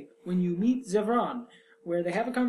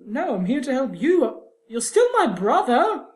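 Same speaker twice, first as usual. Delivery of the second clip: indignant reply; 8 semitones higher, 8 dB louder than usual. Retold or imitated. Player's indignant reply to Carver.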